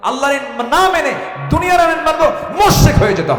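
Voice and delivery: high, full-force preaching shout; thundering warning. Real, not acted. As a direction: hurl the warning at the crowd. (উচ্চ চিৎকারে কঠোর হুঁশিয়ারি)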